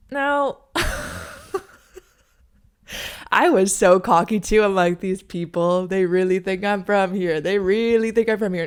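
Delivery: loud, gloating with a laugh; cocky. Retold or imitated. Bragging to viewers that locals bought her act, loud and cocky. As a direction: crow about fooling the locals.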